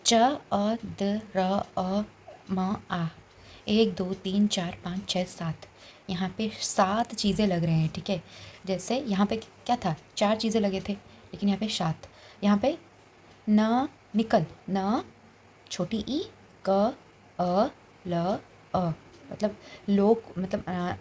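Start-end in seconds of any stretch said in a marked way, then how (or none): 0.1-3.1 "च", "अ", "द", "र", "अ", "म", "आ" each said apart with pauses; sounds one by one. Shown as a demonstration. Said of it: "चंद्रमा को हम कैसे तोड़ रहे हैं". 13.5-18.9 "न", "ई", "क", "अ", "ल", "अ" each said apart with pauses; sounds one by one. Shown as a demonstration. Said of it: "नी को हमने तोड़ा".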